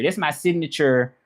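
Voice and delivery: ordinary talk, nothing marked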